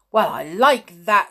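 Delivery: indignant outburst, loud; angrily. A narrator's reading. George furious at being left out, loud indignant outburst. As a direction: snap it out in outrage. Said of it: "said George angrily".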